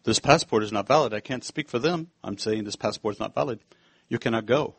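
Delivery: low, stiff official voice; a hint of a stern border guard. Swiss border guard refusing the preacher's passport, low and stiff.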